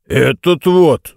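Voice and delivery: put-on drawn-out drunken astonishment; surprised. A narrator's reading. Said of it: "удивился Аланкей". (пьяное удивление, медленно)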